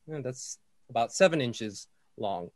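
usual speaking voice

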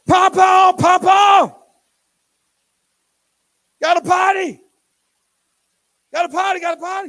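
put-on high, loud little-girl yelling; toddler calling Papa. Little girl calls Papa to the potty, high and loud. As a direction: holler for Papa like a desperate toddler.

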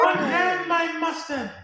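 high, slow hammy villain line. Pretend. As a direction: ham up a comic-book baddie. Voicing Condiment King villain.

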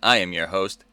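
ordinary talk, nothing marked

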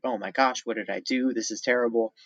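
ordinary delivery

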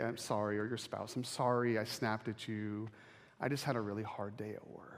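mock apology. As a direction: apologize gently to your spouse. Apologizing to kids, spouse.

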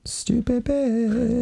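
very high, drawn-out insult; mock taunt. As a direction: stretch it slowly in falsetto. Mock taunt in retold scene.

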